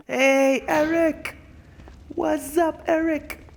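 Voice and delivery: high, loud cartoonish greeting; silly high voice. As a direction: squeal it like a cartoon. Greeting character in funny voice.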